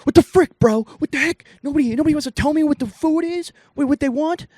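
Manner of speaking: high, loud mock rant; dad's angry outburst. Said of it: "he's like".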